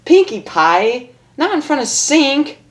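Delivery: high, flustered scolding; embarrassed protest. Acted. Rainbow scolding Pinkie, high flustered voice. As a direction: squeal out a protest.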